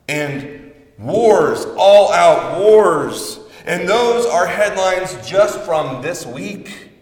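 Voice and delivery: rising to a loud, slow preacher's cry; preaching at full force. Acted. Preacher praying to Jesus about the news, building to a loud cry.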